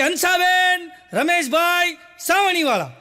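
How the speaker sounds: high, loud, drawn-out shout of names. Retold or imitated. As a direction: bellow the names at the door at night. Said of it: "એટલું બધું જોરથી બોલ્યું".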